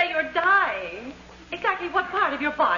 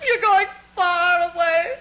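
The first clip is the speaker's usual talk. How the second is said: slow, louder sobbing lament; weeping; 5 semitones higher, 3 dB louder, much slower than usual. Acted. Wife wails as husband seems to leave her. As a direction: wail it through tears, drawing out far.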